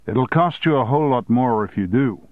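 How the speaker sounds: ordinary delivery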